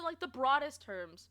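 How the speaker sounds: ordinary delivery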